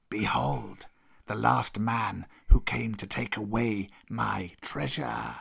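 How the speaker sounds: slow, low-key serpent threat; hushed, long-drawn cobra voice; menacing old cobra. Acted. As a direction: draw it out slowly and ominously. Cobra shows the skull.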